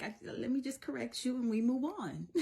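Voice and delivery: high, half-laughing exasperation; imitated frustrated mommy with a laugh creeping in; a little frustrated. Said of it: "I get a little frustrated".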